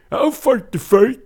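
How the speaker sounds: high, loud, straining tough-guy impression; Sylvester Stallone. Host who's been fighting for years, in a yelled Stallone impression. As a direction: yell it like a film fighter at full strain. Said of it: "I'm gonna use my Sylvester Stallone voice".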